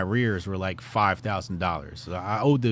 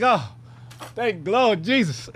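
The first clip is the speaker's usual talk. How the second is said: overjoyed relief; 10 semitones higher than usual. Retold or imitated. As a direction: shout thanks to the Lord. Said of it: "Why are you making so much noise".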